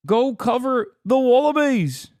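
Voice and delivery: broad mock-Aussie jeer; mock Australian accent. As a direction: heckle in a put-on Australian accent. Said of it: "can't tell if it's you talking".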